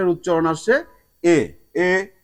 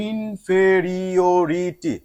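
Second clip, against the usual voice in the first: ইনফেরিওরিটি said very slowly to close the spelling; careful, drawn-out ইনফেরিওরিটি; slow teacher's recap of the word; slow demo word; much slower than usual. Shown as a demonstration. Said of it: "P Y T".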